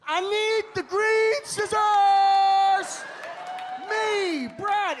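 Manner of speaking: high, slow, whining shout for the scissors; whiny kid shout. Retold or imitated. Lefty kid in class yelling to the teacher, a high whiny shout. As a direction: bellow it like a desperate little kid.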